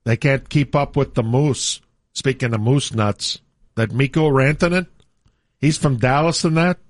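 hammed-up Canadian hosts, eh; mock Canadian morning radio banter. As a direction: play a folksy Canadian DJ. Hosts play Canadian radio bit.

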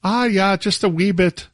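high, hammed-up stage Irish; light stage Irish accent; mock Irish accent. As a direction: play the cartoon Irishman a touch. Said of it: "someone was doing an Irish accent".

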